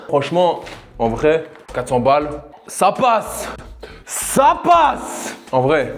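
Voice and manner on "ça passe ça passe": excited. Real, not acted. Excited verdict.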